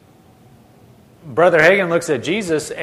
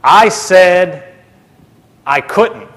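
loud "I said", pause, then "couldn't" brought down; firm correction; 3 semitones higher, 5 dB louder, much slower than usual. Retold or imitated. Jesus settling it with Brother Hagin, firm emphasis. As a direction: state it with a deliberate break before "I couldn't".

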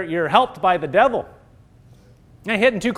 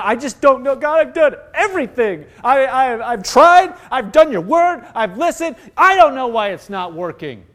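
mock whiny self-pity, higher in pitch; 3 semitones higher, 3 dB louder than usual. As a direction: whine at God, peaking on "I've tried". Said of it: "it's self-pity going".

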